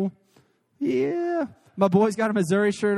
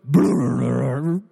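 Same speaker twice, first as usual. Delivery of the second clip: stomach rumble; 8 semitones lower, slower than usual. Retold or imitated. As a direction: rumble it low like a churning belly. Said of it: "All of a sudden it goes".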